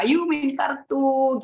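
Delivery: high, drawn-out playful invitation; sing-song, slow and high; playful coaxing. Retold or imitated. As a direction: coax a child into a game, stretched and lifted. Parents coax child with games.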